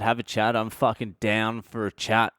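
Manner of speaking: ordinary talk, nothing marked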